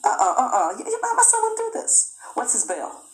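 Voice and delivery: high, stammering protest, my son wouldn't do this; pleading father. Retold or imitated. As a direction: plead for your son's innocence, voice climbing high.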